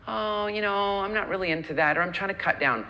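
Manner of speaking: high, whiny, wavering refusal; wishy-washy excuse. Acted. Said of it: "And instead of going".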